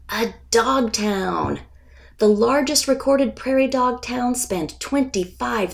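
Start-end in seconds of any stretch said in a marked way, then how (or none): 0.1-1.6 drawn-out, slowed payoff line; slow reveal. Acted. Narrator revealing the dog town to children, slow and drawn out. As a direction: let the answer land slowly. Dramatic reveal in nature read-aloud.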